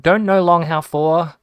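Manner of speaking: loud, high, slowed-down retold text; emphatic mock garbling. Repeating a garbled text to mum, mock emphatic.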